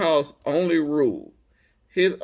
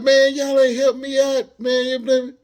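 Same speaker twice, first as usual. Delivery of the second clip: higher, louder mock grievance; pitched-up imitation of a mad guy; retold angry complaint; 10 semitones higher, 6 dB louder, slower than usual. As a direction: bark it like an aggrieved stranger. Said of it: "So he mad".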